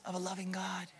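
sudden whispered reassurance; preacher whispers comfort to the congregation; intimate whisper. Acted. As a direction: drop to a whisper to draw them in. Reassuring the congregation of God's welcome.

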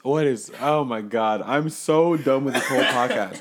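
exasperated laugh. Real, not acted. Breaks off laughing.

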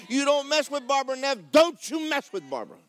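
loud, high preaching; preaching at full force. Acted. Preacher warning the devil off Barbara, loud and rising.

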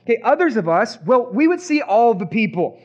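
ordinary talk

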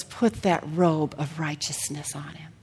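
hushed, breathy whisper of the Father; soft whispered command. Acted. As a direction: whisper it tenderly like a blessing.

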